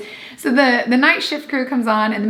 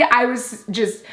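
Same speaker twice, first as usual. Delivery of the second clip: ordinary delivery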